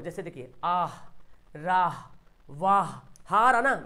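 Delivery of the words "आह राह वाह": आह, राह, वाह each ending on audible breath; clearly sounded h. Shown as a demonstration. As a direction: draw out the vowel and breathe the final h of राह. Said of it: "आवाज़ साफ साफ जाहिर हो". (आह, राह, वाह में हा साफ बोलें)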